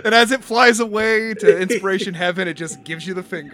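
talking through laughter; giggling while telling it; laughing narration. Real, not acted.

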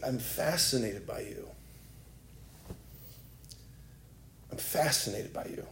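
very soft, low, intimate assurance; hushed divine love, gently voiced; tender reverence. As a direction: let it sink in, almost under the breath. Voices the Lord's tender words.

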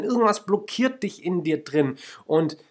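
usual speaking voice

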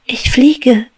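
high, loud thrilled shout; a little bee's jubilant cry; joyful cry. Acted. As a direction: cry out in delight at flying. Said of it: "rief sie".

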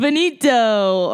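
drawn out like a big ending; mock finale. Grace proposing her catchphrase, slow mock finale.

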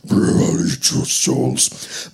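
slow, breathy possessed voice; demonic whisper. Retold or imitated. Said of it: "demonically possessed and going like".

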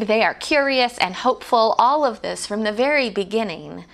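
ordinary talk

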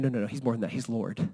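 soft, low reverent realization; dropping your voice in worship; hushed awe. Acted. Believer realizing Jesus is Lord, hushed and reverent.

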